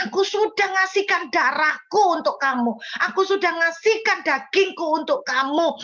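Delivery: fervent retold sermon; pastor's words preached. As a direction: plead like a pastor calling a child home. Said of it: "Lalu pendeta ini bilang".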